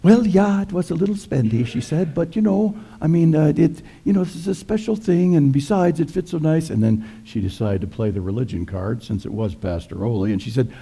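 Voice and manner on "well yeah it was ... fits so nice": higher coaxing wife's voice; Lena, wheedling. Retold or imitated. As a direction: sweet-talk him past the price. Lena wheedling her husband.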